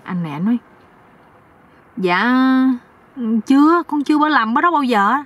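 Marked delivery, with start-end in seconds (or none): 2.0-5.1 high-pitched innocent denial; naive young husband. Acted. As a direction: answer like a clueless youngster, pitched up. (giọng chàng trai cao, ngây ngô)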